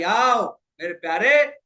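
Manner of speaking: slow, drawn-out beckoning; open-armed invitation, stretched out; nature calling out. Acted. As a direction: call a loved one in, unhurried. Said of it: "बाहें फैलाकर आपको पुकार रही".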